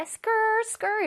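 "skirt" cut to a trendy "skrr"; 유행어 소리 흉내. Retold or imitated. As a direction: imitate a cool "skrr" for "skirt". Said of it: "이런 소리 많이 내던데". ("skirt"를 멋부리는 "스껄"처럼)

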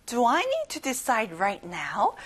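very slow, carefully spaced question; slow model question. Shown as a demonstration. Teacher modelling a question for the class to repeat. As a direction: read it slowly for learners. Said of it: "같이 말해봐요".

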